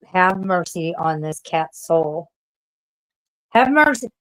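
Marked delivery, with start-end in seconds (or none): none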